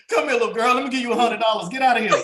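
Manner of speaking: high, laughing mock dad voice. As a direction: melt and hand over the cash. Voicing himself giving daughter money.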